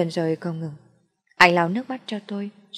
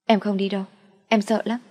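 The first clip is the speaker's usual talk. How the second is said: frightened; 3 semitones higher than usual. A narrator's reading. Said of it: "Tôi sợ hãi quá".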